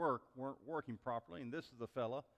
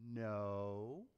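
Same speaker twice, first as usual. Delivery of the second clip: put-on low, hushed, drawn-out child answer; small whispering voice; 6 semitones lower, 6 dB quieter than usual. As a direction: imitate a secretive little child. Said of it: "the small little voice whispered".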